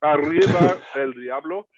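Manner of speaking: saying it around ice in the mouth. Real, not acted. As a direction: mouth full of ice, words blurred. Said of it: "I have ice in my mouth".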